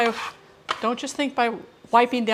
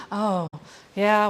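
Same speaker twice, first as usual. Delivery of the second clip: ordinary talk, nothing marked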